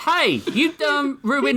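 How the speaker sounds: high, drawn-out hillbilly whine. Acted. Deputy whining at the FBI agent over his broken model. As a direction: whine like a wronged country deputy.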